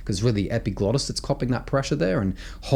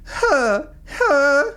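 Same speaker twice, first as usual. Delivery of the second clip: high, louder whimpering huh; cry sound; 4 dB louder than usual. Shown as a demonstration. Coach demos the cry inflection for students. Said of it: "that inflection".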